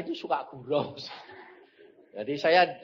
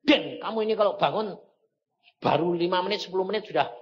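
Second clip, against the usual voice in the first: raised-pitch parental telling-off; scolding father; 4 semitones higher, 4 dB louder, faster than usual. Retold or imitated. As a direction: tell off the son, mildly acted. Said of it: "pernah marah sama anak saya".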